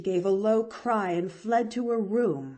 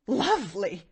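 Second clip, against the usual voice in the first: mild scorn; 3 dB louder than usual. A narrator's reading. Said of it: "scorned the woman".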